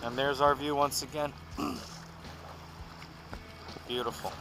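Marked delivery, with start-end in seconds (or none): none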